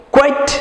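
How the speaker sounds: কোয়াইট said clearly as kwait, ending in -ait; pronunciation model. Shown as a demonstration. Teacher modelling কোয়াইট for pupils to repeat. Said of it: "আইট".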